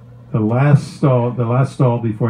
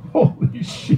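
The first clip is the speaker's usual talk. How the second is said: stunned whisper; 14 semitones higher, slower than usual. Retold or imitated. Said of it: "the guys in the room were like".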